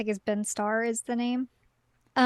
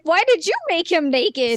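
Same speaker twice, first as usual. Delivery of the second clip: indignant question, raised in pitch and volume; mock scolding; 5 semitones higher, 5 dB louder than usual. Player questioning Sid about the jail scene, mock indignant. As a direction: call him out in playful outrage.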